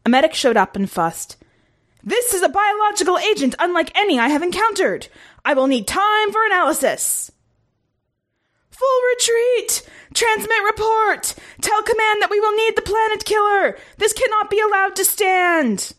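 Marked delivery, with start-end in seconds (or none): 2.0-7.3 high, formal medic voice; alien medic. A narrator's reading. Medic assessing stricken commander, clinical. 8.7-15.9 very high, strained commander shout; shouting orders. A narrator's reading. Dying commander shouting orders to crew, strained. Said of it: "the commander continued to shout orders".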